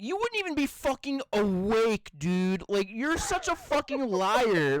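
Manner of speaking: high, disbelieving scoff; incredulous. Retold or imitated. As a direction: call him a liar, amazed. Incredulous retort to friend.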